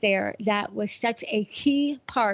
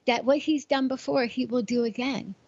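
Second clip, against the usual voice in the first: usual speaking voice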